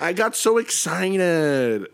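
tipsy and giddy. Real, not acted. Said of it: "I'm, like, a little tipsy".

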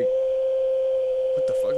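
very high, drawn-out shriek; shocked. Retold or imitated. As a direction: screech it slow and high.

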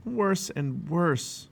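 miserable. A narrator's reading. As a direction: drag it out miserably.

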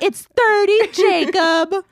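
mock-exasperated, pronounce THIRTY properly at a classmate; annoyed correction. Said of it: "it was actually really annoying".

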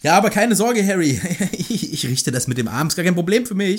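high, chuckling mock reassurance; hammed-up self-assured helper; breezy Lockhart. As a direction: brush it off with exaggerated cheer. Voices Lockhart's breezy reassurance of Harry.

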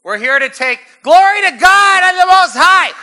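very high, full shout of praise; warrior seized by prophecy. Acted. Second group arrives and starts prophesying, ecstatic shout. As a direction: erupt into hammed-up prophetic shouting. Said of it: "And they start prophesying".

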